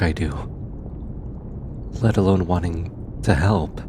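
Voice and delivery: lightly voiced researcher, fond and teasing, with thoughtful pauses. Researcher thanking their stubborn bodyguard, fond and sincere. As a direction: talk gently to a trusted companion, unhurried, hesitating between phrases.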